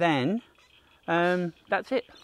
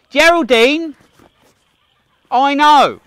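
calling out; 10 semitones higher, 11 dB louder, slower than usual. Real, not acted. Calling out to Geraldine on the path, loud. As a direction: call the name out, rising and bright.

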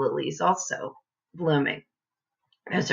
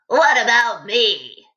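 louder, slow grunting ape voice; 5 semitones higher, 5 dB louder than usual. A narrator's reading. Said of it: "grunted the ape".

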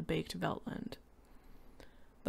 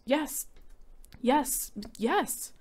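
high, teary eager yes; frantic tearful yes; 8 semitones higher, much slower than usual. A narrator's reading. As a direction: nod it out near tears. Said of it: "a frantic, watery-eyed nodding".

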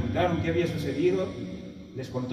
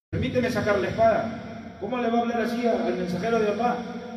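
higher-pitched imitated outrage; indignant protest; 7 semitones higher than usual. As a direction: protest as an offended defender. Omar, indignado, defiende al profeta. (protesta indignada)

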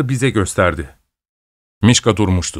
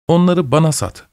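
shy request, a bit higher; bashful asking; 7 semitones higher than usual. A narrator's reading. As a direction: ask timidly, half ashamed. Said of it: "utana utana söylendi".

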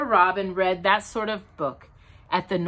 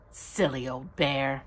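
loving; 7 semitones lower, 6 dB quieter than usual. A narrator's reading. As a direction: say it tenderly, lingering on each word. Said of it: "in such a loving voice".

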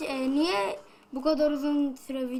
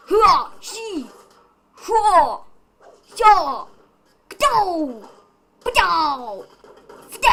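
much higher, loud martial-arts yells; battle cries; 4 semitones higher, 10 dB louder, much slower than usual. Acted. Gamer fighting the boss, loud kung-fu yells. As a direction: bark out kung-fu shouts with each hit.

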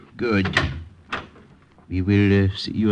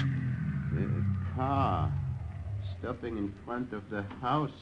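softly warning of the car outside; hushed alarm; 9 dB quieter, slower than usual. Acted. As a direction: keep it down, they might hear. Strangers arriving late at night.

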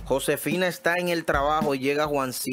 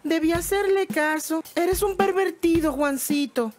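much higher, scolding woman; indignant wife; 11 semitones higher than usual. Acted. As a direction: tell him off, high and offended.

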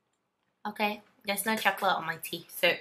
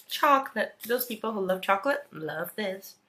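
ordinary delivery

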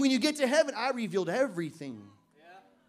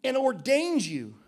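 slow, loud preacher's scripture read; 4 dB louder, slower than usual. Acted. Pastor reading scripture aloud to church, slow and weighty. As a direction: lay each word down slowly.

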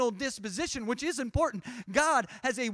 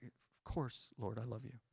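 quiet reply; 9 semitones lower, 14 dB quieter than usual. Retold or imitated. Peter's quiet answer to Jesus.

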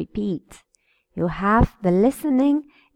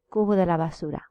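normal talking voice

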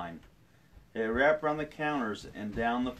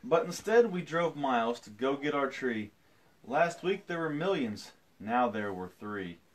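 storytelling; 4 semitones higher than usual. A narrator's reading. Reads picture book aloud.

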